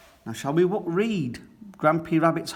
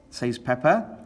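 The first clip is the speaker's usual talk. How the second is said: plain reading voice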